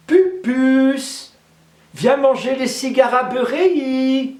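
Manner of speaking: high, loud call to dinner. Acted. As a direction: call her husband from the window. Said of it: "elle a appelé son époux".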